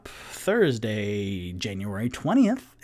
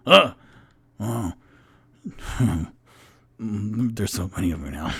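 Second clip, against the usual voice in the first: startled grunt, then lower dwarf voice; Onyx; 4 semitones lower, 3 dB louder than usual. Acted. Onyx sees copies of himself, lightly voiced dwarf. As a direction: react with a grunt, then drop the pitch. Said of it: "Onyx looks over at the Rock Onyx and goes".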